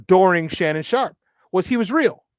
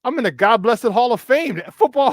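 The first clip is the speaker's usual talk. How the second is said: imitating Shannon; 6 semitones higher than usual. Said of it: "He's like".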